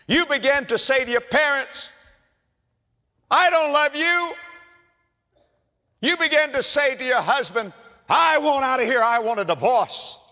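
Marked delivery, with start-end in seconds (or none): 3.3-4.3 much higher and louder, hurled at parents; acted child's furious cry at parents; acted angry outburst. Acted family quarrel quote. 8.1-10.2 acted angry demand. Acted marital quarrel quote.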